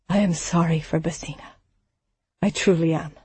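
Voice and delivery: soft, quiet, sorry; gentle. Acted. Samson gently apologizes to Barnard for his daughter's death. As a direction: gently console the grieving man, quietly. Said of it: "Samson's voice was gentle".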